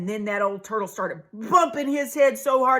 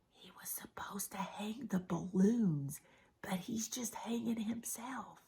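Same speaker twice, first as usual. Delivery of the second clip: breathy whispered aside to the listeners; 16 dB quieter than usual. Real, not acted. Storyteller whispering a joke to the kids.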